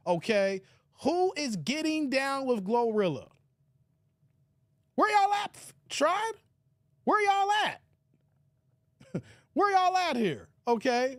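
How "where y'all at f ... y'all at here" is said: high-pitched call to the crowd. Real, not acted. Calls out to the viewers.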